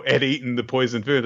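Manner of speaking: ordinary talk, nothing marked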